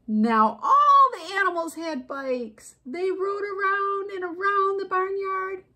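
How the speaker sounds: high, slow, swelling read-aloud; excited storybook narration. A narrator's reading. Narrator revealing all the animals riding bikes, excited storybook narration. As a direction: lift it high and stretch the excitement. Reads a picture book.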